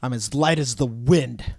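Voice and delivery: slow, dramatic mock-poetic line; hammed-up grandeur. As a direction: declaim it like a wistful hero. Joking about "the Wind.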